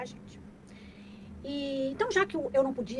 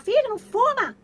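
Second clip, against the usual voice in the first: much higher, loud mock order; mock nagging parent; 11 semitones higher, 11 dB louder than usual. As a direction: nag like a hypocrite parent. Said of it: "O que que adianta você falar".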